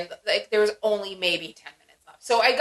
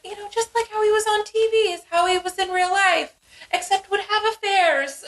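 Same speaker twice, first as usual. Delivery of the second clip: imitating what people said, pitched higher; a higher put-on voice for the crowd's opinion; lightly mocking the public's take, higher; mock everyone; 11 semitones higher than usual. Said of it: "But everyone was like".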